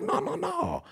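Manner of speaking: high, soft no-no-no; softly sung-out, high-pitched refusal; mock knowing denial. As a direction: imitate a mentor waving off the excuse in a high, light voice. Mentor's knowing denial, retold.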